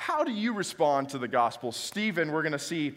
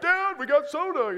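put-on kid voice; 11 semitones higher, 4 dB louder than usual.

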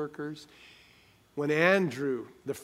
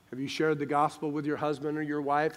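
ordinary delivery

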